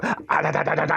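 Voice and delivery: rapid string of barks; imitated dog barking. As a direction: bark furiously fast like a dog.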